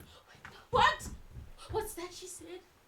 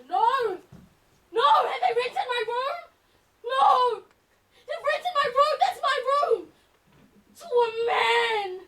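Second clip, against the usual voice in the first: high, loud, anguished cries; raised, horrified voice; distraught protest; 8 semitones higher, 6 dB louder than usual. Acted. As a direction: cry out helplessly at intruders. Watches strangers taking over own room.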